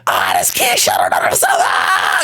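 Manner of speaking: high squealed taunt into mock laughter; jeering schoolkids. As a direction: point and howl like a mocking kid. Acted kids jeering at him.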